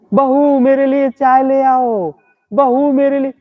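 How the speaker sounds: put-on high, slow old mother-in-law calling for tea. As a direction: play the bossy mother-in-law calling her daughter-in-law, high-pitched. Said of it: "सास को क्या देती होगी तू". (ऊँची आवाज़ में सास की नकल)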